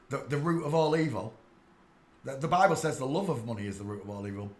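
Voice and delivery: normal talking voice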